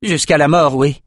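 Bannon echoing. A narrator's reading. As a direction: throw "mort" back at him. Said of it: "répéta Bannon".

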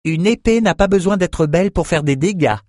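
lightly voiced plucky youth; forced good cheer. A narrator's reading. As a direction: put a brave smile on it. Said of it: "se força à sourire".

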